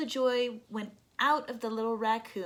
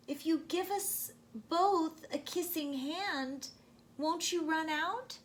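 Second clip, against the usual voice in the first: put-on small child; 6 semitones higher than usual. A narrator's reading. Small child asks worried question.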